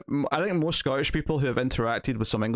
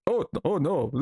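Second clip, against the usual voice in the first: mock fluster; 5 semitones higher than usual. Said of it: "tough English soldier is like".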